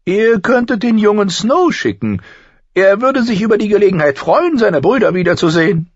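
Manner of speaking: deutlich höher und lauter, spöttischer Zwerg; Tyrion, hohe Stimme. A narrator's reading. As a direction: hell und keck sprechen, wie ein Zwerg. Tyrion schlägt Snow vor. (Tyrion antwortet dem Lord Commander, hoch und keck)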